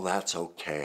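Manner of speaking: usual speaking voice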